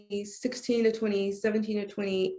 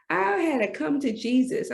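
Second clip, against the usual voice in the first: ordinary delivery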